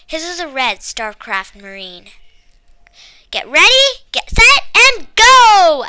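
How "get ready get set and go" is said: higher, louder race-start shout; girl shouting the race start. A narrator's reading. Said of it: "I shouted".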